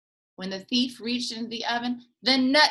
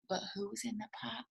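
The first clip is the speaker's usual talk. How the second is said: suspense; 16 dB quieter, faster than usual. Acted. Gasp before snake reveal.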